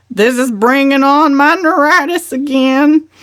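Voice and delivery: high, loud complaining voice; complaining cousin. A narrator's reading. Cousin Gladys complaining to the family, loud and high. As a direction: whine about her ailment.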